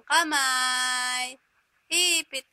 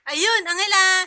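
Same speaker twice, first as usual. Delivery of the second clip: calling out; 3 semitones higher, 9 dB louder than usual. Real, not acted. Calling out to baby.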